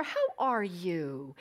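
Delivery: slowly, gently concerned; caring. Retold or imitated. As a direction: ask as tenderly as you can. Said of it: "As caring as I could".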